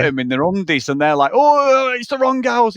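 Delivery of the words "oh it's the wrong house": high, drawn-out "oh", louder at the start; mock alarmed realisation. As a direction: imitate blokes realising their blunder. Retold comic debt-collectors' realisation.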